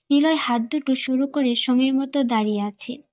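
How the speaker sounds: plain reading voice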